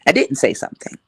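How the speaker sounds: ordinary talk, nothing marked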